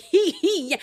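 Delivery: high, laughing stammer. Real, not acted. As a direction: break up laughing mid-word. Laughing while retelling the chimp incident.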